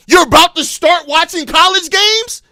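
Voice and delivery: incredulous rising question, getting heated; angry disbelief. Real, not acted. Fan mocking the manager's college-games plan, heated. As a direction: demand it in disbelief.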